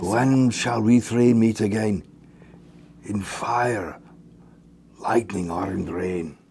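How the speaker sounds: put-on witches' line, trying a Scottish accent; a half-hearted Scottish lilt. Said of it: "Scottish are we now?".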